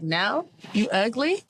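slow sassy mock turn-down; mock refusal. Teasing about refusing a date, slow and sassy. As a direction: drag it out teasingly. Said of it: "Be like".

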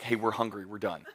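low, very soft and sheepish; hushed complaint. Retold or imitated. Disciple complaining to Jesus, low and sheepish. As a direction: mutter it under your breath.

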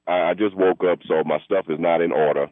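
ordinary talk

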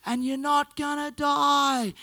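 high, drawn-out mock yell; imitated child's yell. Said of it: "at the top of his voice yells".

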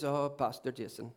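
slow, low attempt at an Indian accent. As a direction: imitate an Indian friend's accented greeting, drawn out. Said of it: "Let's not do the accent".

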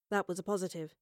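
plain reading voice

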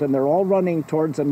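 ordinary delivery

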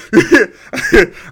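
a sudden high burst of laughter; loud laugh. Real, not acted. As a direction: let out a big hyped laugh.

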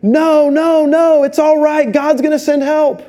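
high, insistent brush-off; lightly voiced stubborn man on the roof; stubborn refusal. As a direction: wave the rescuers away, sure God will come. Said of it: "and he's going".

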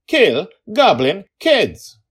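high, very loud, slow defiant shout; girl yelling. Acted. Small dragon girl protects goblin kids, furious yell. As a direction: bellow each word as an order. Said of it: "Dragon Lil yelled at him".

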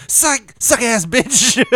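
high, loud, slow mocking dog voice; stretched-out high jeering dog; taunting dog. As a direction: drag out the taunt gleefully. Dog taunting after fake-out.

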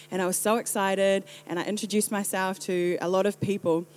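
ordinary delivery

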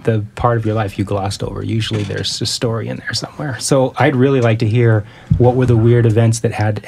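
ordinary talk

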